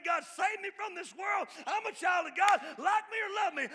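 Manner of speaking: high, loud shouted declaration; bold cry to God. Acted. Preacher crying out for the church, full loud shout. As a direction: proclaim it at full force to heaven.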